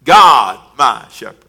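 loud "God," then "my shepherd" falling; punched God. Shown as a demonstration. Pastor repeats the verse to the church, punching "God". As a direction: throw "God" out, let "my shepherd" drop. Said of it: "Glory to God".

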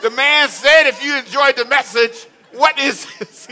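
high loud mock outrage; broad singsong kids theatre. Voicing himself at stingy church.

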